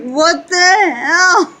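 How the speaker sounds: high, loud, slow half-crying what the hell; jolted awake and outraged; startled yell. Retold or imitated. Wife woken from dead sleep by the fart, mock outraged yell. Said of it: "and I'm like".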